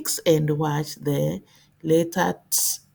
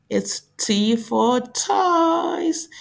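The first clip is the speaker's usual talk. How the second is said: high, slow and sing-song; cheery teacher model; 8 semitones higher, slower than usual. Shown as a demonstration. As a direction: sell the word to little kids. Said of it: "teacher modelling".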